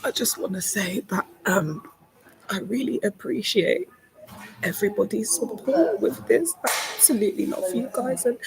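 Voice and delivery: overwhelmed and close to crying; real overwhelmed thanks. Real, not acted. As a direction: voice climbing high and fragile with real emotion. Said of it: "I'm just feeling a bit overwhelmed".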